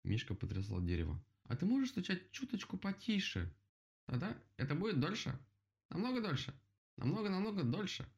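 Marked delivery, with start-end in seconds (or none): 1.4-3.5 high-pitched request called out; calling up. A narrator's reading. Bear calls up to woodpecker. 4.1-8.1 high-pitched called-down reply; calling out. A narrator's reading. Woodpecker answers while still knocking, high cry. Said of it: "прокричал дятел".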